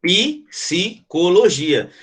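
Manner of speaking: "Psicologia" very slow, psi-co-lo-gi-a; "Psicologia" stretched for the class to separate; slow syllable demo. Shown as a demonstration. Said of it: "é assim que separa".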